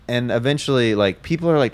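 normal talking voice

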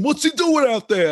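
ordinary talk, nothing marked

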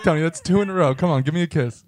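ordinary delivery

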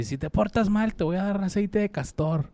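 scolding owner voice. Retold or imitated. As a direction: threaten like a stern owner. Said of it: "uno donde le dice".

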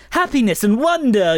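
hammed-up storybook wonder; sing-song, overblown Peter Pan enthusiasm; gushing American version. Mocking the American cast as too Hallmark, gushing wonder. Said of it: "the American version feels like".

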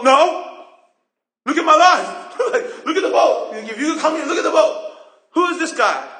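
high, loud laughing protest; defensive, half-laughing "look at my life"; exasperated protest. Retold or imitated. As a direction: disciple protesting his empty boat. Defensive reply to Jesus.

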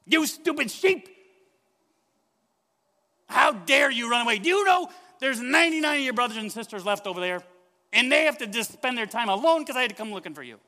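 mock scolding shout, fast and loud; angry yelling. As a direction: berate the sheep at full volume. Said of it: "He doesn't yell at him".

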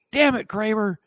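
high-pitched mock scolding of a dog; exasperated dog owner's retold shout; retold angry yell. Owner scolding his dog from downstairs, retold angry yell. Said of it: "I'm yelling at him".

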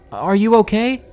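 high, loud call from another room; yelling. A narrator's reading. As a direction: call out loudly, worried. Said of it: "My mom heard me and yelled".

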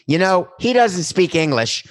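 mock the pundit, loud and drawn out; high, loud, slow imitation of a TV pundit; mocking Stephen Smith. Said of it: "and says".

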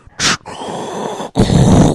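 bursting out laughing; loud laugh. Real, not acted. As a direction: howl with laughter. Laughing at own joke.

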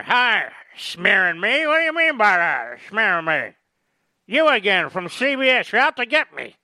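hammed-up Biden brushing off the press; mock Biden. Host mocking Biden snapping at a CBS reporter, high indignant whine.

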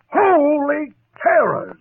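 loud, high, slow cry of dismay; shocked outburst. Acted. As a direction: blurt it out at the sight of the damage. Finds hole in his house.

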